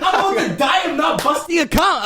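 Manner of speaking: high playful yell; mock shout. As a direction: belt it out high, joking. Shouted joke.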